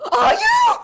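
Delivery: high, loud laughing shout; shrill accusation. Acted. NPC accusing player character.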